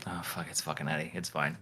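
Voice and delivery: a resigned under-the-breath shrug; mock resigned mutter. Gym friends shrugging off Eddie's jokes, muttered quietly. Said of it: "they're going like".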